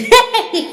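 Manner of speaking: a high wicked Grinch chuckle; throaty laugh. Acted. Grinch laughing at his own idea, throaty chuckle. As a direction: cackle with glee at the plan. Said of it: "the Grinch laughed in his throat".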